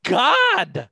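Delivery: high exasperated cry; loud, high exasperation. Real, not acted. Outraged at friend not seeing film.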